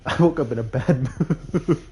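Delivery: giggling as she admits it; laughing. Real, not acted. Telling viewers about her bad mood, laughing.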